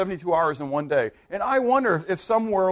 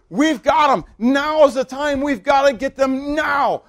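high, loud battle urgency; rally the troops, raised and insistent; urgent rallying cry; 7 semitones higher, 6 dB louder, slower than usual. Acted. Joshua seeing victory as darkness comes, urgent.

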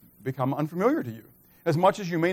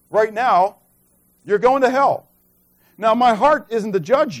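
raised, higher preaching voice pressing the point; 5 semitones higher, 5 dB louder than usual. Acted. Pastor urging the congregation about heaven, preaching at full force. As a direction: preach it out to the room, higher and louder.

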